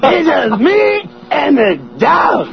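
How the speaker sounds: high, slow comic holler; Chris Rock. Acted. Host bragging about being home alone, Chris Rock impression. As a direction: stretch each phrase like a shouting stand-up. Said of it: "That's Chris Rock".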